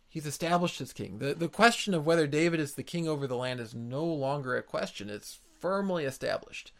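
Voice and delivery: ordinary talk, nothing marked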